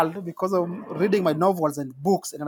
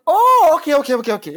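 mock surprise; 9 semitones higher, 6 dB louder than usual. [name] accepting excuse.

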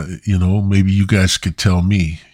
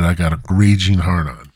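normal talking voice

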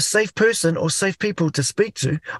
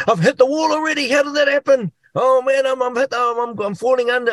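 sudden high-pitched alarm, words tumbling and stuttering; panicked; 6 semitones higher than usual. Retold or imitated. A man reliving panic when he hits the wall, panicked. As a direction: voice jumps up as if already hitting the wall.

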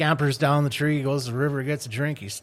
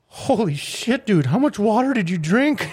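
stoned astonishment; 10 semitones higher, 8 dB louder than usual. Acted. Stoned monkey looks down at alligator, loudly astonished. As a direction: stretch it out in amazed stoner disbelief.

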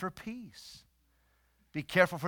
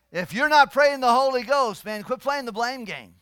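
loud, raised-pitch sermon voice; full-force preaching; 8 semitones higher, 12 dB louder than usual. Real, not acted. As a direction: drive the point home to the congregation.

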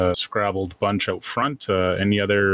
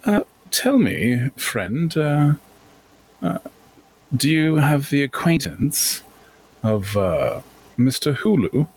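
higher, impressed, courteous gentleman; intrigued gentleman merchant; 6 semitones higher, slower than usual. Acted. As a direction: react with drawn-out, refined interest. Calder reacts to the firm's name.

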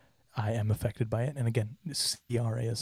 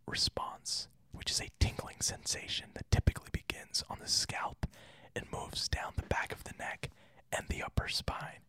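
ASMR whisper; 9 semitones higher, 7 dB quieter than usual. Shown as a demonstration. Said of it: "which is what I think I'm going to do".

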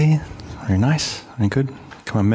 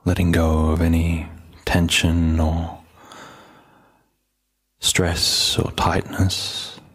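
low, even meditation voice with long pauses; calm, unhurried settling-in voice; guided meditation; 4 semitones lower, slower than usual. Real, not acted. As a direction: gently guide listeners inward, leaving space between phrases.

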